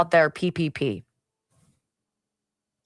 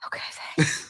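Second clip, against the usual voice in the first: tiny breathless thanks, barely voiced; meek whisper; 7 dB quieter than usual. Host retells thanking the co-host, sheepish whisper. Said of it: "And I'm like".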